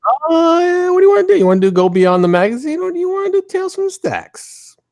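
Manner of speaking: very high, squeaky put-on question; exaggerated sky-high voice; silly high voice. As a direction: ask it in a comic falsetto. Puts on silly voice asking.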